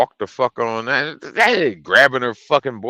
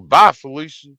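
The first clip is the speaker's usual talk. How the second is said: loud, higher dismissive goodbye; jeering farewell, called out; mocking send-off; 6 semitones higher, 11 dB louder than usual. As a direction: re-enact taunting someone walking out. Retelling mocking send-off to customer.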